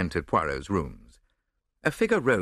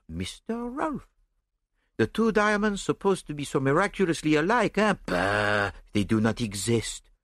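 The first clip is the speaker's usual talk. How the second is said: animated French-flavoured explanation, often higher; lively little detective, amused and dismissive; genial foreign detective, triumphant; 8 semitones higher than usual. A narrator's reading. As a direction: lay out the solution with relish.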